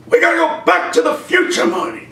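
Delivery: high, urgent mad-scientist voice; Doc Brown. Acted. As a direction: blurt it out in a panic. Doc urges Marty in skit.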